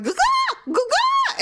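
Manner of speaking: very high squawked goo-gaw, goo-gaw; squawking hen. Retold or imitated. Imitating the mother hen sounding the alarm, high squawks. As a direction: honk it out like an alarmed hen up a tree. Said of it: "She is loudly going".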